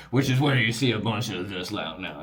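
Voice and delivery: rough attempt at a Baton Rouge accent. Shown as a demonstration. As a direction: have a go at a French-tinged Louisiana accent. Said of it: "the Baton Rouge".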